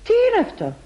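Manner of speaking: high, sharp surprise; landlady finding the guest asleep in the kitchen; shocked question. Acted. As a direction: gasp it out in shock. Landlady finds drunk guest asleep.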